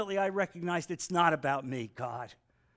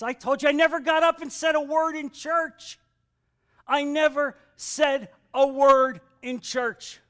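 loud, higher, insistent preaching; raised pulpit voice; emphatic preaching; 6 semitones higher, 7 dB louder than usual. Real, not acted. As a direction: preach it forcefully, leaning on each word.

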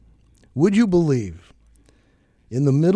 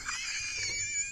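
squealing laugh, very high; high burst of laughter; 15 dB quieter than usual. Real, not acted. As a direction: shriek with laughter.

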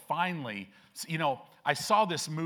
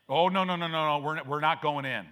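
a loud, flustered no to the promised land; a raised, hammed-up no; loud refusal; 6 dB louder than usual. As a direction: refuse it loudly, like stubborn people. Israelites refuse to enter the land.

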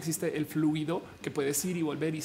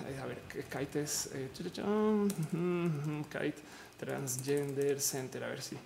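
bajito y lento, para sí misma; hablando entre dientes; 4 semitones lower, 7 dB quieter, slower than usual. Real, not acted. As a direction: piensa en voz baja mientras buscas algo. Busca un nombre mientras transmite. (murmullo distraído de quien busca un dato)

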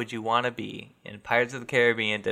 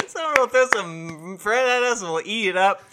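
laughing; 7 semitones higher, 5 dB louder than usual. Real, not acted. Laughing silly bit.

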